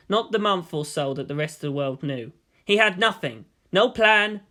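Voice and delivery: ordinary delivery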